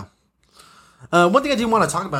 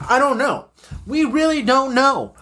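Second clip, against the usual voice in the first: ordinary talk, nothing marked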